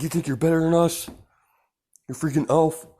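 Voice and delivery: high, loud jeering challenge; angry rival yell. Retold or imitated. Rival yelling at Izuku across the stadium, high jeering shout. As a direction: shout it up at him, indignant.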